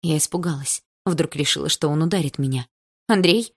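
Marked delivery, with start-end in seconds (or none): 3.1-3.5 loud, alarmed call of his name; frightened cry. A narrator's reading. Mother cries out in fear to her husband. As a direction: cry out in fear to stop him. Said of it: "испуганно воскликнула мама".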